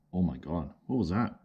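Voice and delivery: usual speaking voice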